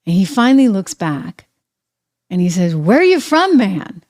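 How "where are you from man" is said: mock shout, higher on the question, dropping on "man"; half-shouted imitation of a retort; imitated shout. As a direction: call it back like the retold president. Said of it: "And then he shouts out".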